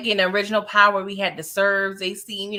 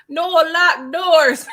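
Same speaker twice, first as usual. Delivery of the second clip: movie quote; 6 semitones higher, 5 dB louder, much slower than usual. Retold or imitated. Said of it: "He probably was like on, you know, Friday After Next".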